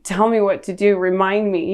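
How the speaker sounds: ordinary talk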